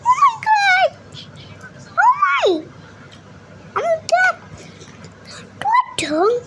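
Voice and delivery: very high squealing gush. Real, not acted. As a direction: shriek it like a delighted fangirl. Squealing over cute item.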